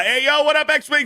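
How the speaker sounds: high, loud hype greeting; excited shout-out. Real, not acted. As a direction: hype up the viewer's name. Greeting chat donor excitedly.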